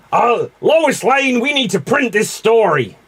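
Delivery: loud, high newspaper boss; hammed-up editor giving orders. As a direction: call out urgently like a newspaper editor.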